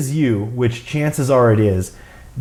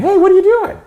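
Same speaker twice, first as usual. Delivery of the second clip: high squeaky parrot imitation; tiny voice; 4 dB louder, faster than usual. Parrot being recorded on camera, tiny high voice. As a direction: mimic the talking bird, pitched way up. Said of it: "this tiny voice".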